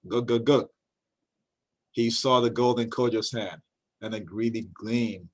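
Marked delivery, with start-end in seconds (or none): 0.1-0.7 a gurgling trickster's chuckle; sly chuckle. A narrator's reading. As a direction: chuckle greedily in the throat. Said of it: "Anansi chuckled".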